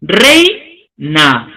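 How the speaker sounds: "reina" split slowly as rei, pause, na; teacher's syllabified "reina", "rei" rising and "na" dropping; syllable-by-syllable reina. Shown as a demonstration. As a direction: say "reina" in two separate beats, keeping "ei" together. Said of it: "decimos reina".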